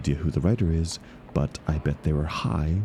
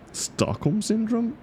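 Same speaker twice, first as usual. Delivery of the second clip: slow, very high, disbelieving question; incredulous echo; slower than usual. Acted. Echoing a club member's suggestion back, slow and very high in disbelief. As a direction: echo their words back as if they can't be serious.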